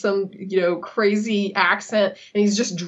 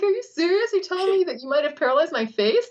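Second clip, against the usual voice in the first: much higher, half-laughing disbelief; incredulous, laughing; 8 semitones higher than usual. Retold or imitated. To the surgeon, laughing high disbelief. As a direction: squeak it out between laughs. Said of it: "And I'm cracking up".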